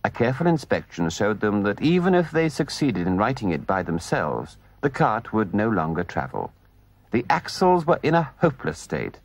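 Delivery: ordinary delivery, nothing marked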